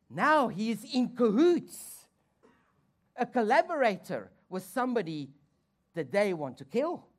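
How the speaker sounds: loud, high preacher's drive; full-force preaching. Acted. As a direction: hammer the point home to the church.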